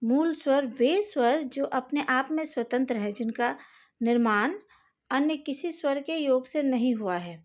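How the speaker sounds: ordinary talk, nothing marked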